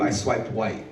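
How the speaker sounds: dropped low and soft; understated punchline. Acted. Boyfriend to girlfriend after getting off, understated low punchline. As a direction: let the punchline fall away quietly.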